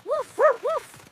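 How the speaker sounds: two high little woofs; puppy woof. Acted. Puppy answers a child calling it over, high woofs. Puppy asked to run fast.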